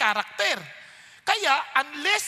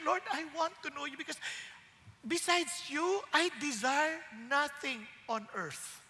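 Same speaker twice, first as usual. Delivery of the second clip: prayerful; 8 dB quieter than usual. Pretend. Voicing a believer's prayer.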